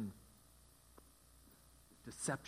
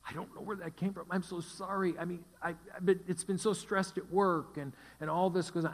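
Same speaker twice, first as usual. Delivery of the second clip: higher, pleading voice of a sorry husband; 6 semitones higher, 4 dB louder than usual. Retold or imitated. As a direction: plead like a husband caught out, voice up high. Husband apologizing in a story.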